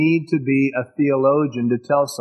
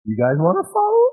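sarcastic jab; 4 semitones higher than usual. Acted. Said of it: "that really infuriated them".

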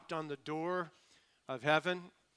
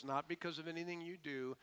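ordinary talk

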